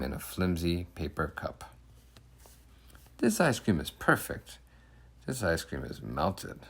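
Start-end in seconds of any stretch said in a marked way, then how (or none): 3.2-4.6 high, delighted and satisfied; happy ice cream. Acted. 5.2-6.6 disappointed ice cream. Acted. The boy served through a side window, quietly disappointed.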